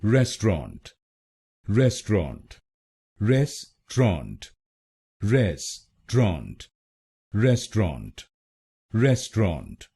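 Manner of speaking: रेस्टोरेंट said as res-tront in two syllables; रेस्टोरेंट squeezed into res-tront; two-syllable pronunciation. Shown as a demonstration. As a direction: repeat रेस्टोरेंट as res-tront, no middle vowel. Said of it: "तो बनेगा".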